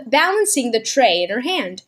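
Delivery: ordinary delivery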